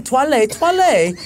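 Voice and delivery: toilet said as twa-lay, pleading; insistent toilet. Retold or imitated. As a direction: keep saying toilet the French way, twa-lay. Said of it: "And I kept saying".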